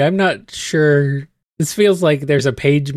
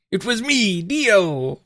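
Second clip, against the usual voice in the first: mock villain reveal; 5 semitones higher, slower than usual. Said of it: "dramatic villain monologue time".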